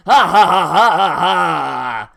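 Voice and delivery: slow, loud, hammed-up evil cackle; evil villain laugh. The Demon Lord's minion gloating at adventurers, slow loud evil laugh. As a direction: throw your head back and cackle like a cartoon villain.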